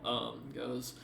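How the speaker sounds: normal talking voice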